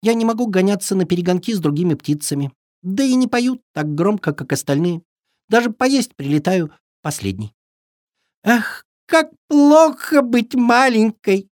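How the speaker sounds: little bird complaining sadly; cartoon bird voice. (жалобный голосок птички)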